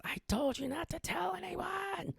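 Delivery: very high, slow, drawn-out wailing cry; high-pitched screaming woman. Retold or imitated. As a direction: wail it way up high and stretch it out. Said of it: "she starts screaming".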